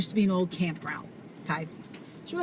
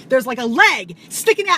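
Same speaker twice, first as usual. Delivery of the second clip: normal talking voice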